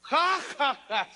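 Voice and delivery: mocking laugh. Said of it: "se ríe el faraón".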